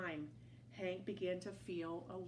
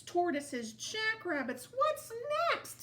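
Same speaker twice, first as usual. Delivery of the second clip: exasperated; 13 semitones higher, 5 dB louder than usual. A narrator's reading. Exasperated list rising.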